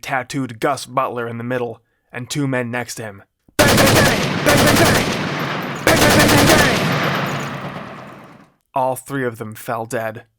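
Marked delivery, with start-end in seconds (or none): 3.6-8.5 very loud, high bang bang yells; shouted gunshot sounds. Shown as a demonstration. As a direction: blast out gunshot noises. Narrator imitates gunfire.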